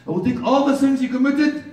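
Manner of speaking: high, loud proclamation of mercy; ringing sermon promise; full-force preaching. Real, not acted. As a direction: proclaim it to the whole congregation, punching ALL.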